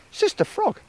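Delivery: very high crow call. Acted. Crow calling to Sister Frog again, very high voice. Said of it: "said Crow".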